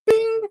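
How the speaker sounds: very high, bright ding; sound effect ding. Acted. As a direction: chirp it like a notification ping. Modding a chat viewer.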